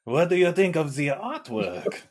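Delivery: high-pitched hammed-up French accent; terrible French accent. [name] replays his undercover art show act, terrible French accent. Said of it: "terrible French accent".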